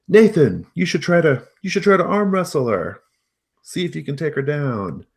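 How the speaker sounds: loud, high teasing call across the room; hollering crowd. Acted. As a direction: holler at him to take her on. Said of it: "someone hollers at him".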